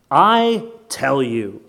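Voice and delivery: very slow, weighty declaration; solemn statement of authority; authoritative pronouncement. Acted. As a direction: pronounce it with a long, heavy I. Quotes Jesus, then questions it.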